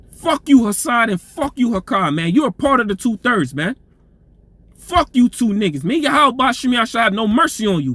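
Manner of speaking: building from bitter complaint to shouted curses; riled-up tirade. Real, not acted. Preacher cursing out his enemies, a real riled-up shout. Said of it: "Sometimes you get riled up".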